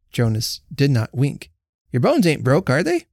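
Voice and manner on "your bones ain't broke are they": lightly voiced sturdy Jenny, barely higher; brisk neighbour woman. A narrator's reading. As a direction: ask him plainly and briskly. Jenny questions Jonas.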